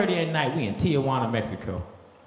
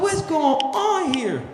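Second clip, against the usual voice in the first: high, slow, stunned question; bewildered; 10 semitones higher, much slower than usual. Retold or imitated. Lost in the Tijuana club, slow high bewildered question. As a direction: drag it out in disbelief.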